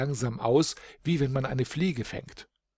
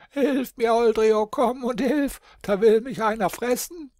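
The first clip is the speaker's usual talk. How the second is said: much higher, louder, bleating goat voice; pitiful bleating goat; 11 semitones higher, 5 dB louder than usual. A narrator's reading. Goat Schimmermilch crying to Holdrio for help, high bleat. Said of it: "gar kläglich meckern".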